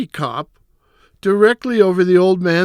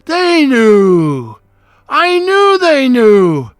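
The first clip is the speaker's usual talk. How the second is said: drawn-out, rising cries of panic; panicked realization; 2 semitones higher, 5 dB louder, much slower than usual. Acted. Murderer sure the cops know, frantic. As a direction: wail each phrase long and loud as panic takes over.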